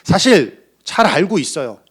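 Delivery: normal talking voice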